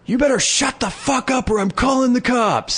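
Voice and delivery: high yelled threat from an upstairs window; yelling neighbor. Retold or imitated. Neighbor yelling from a window at the raving man. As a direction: bark a warning down to the street. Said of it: "Hear a window open".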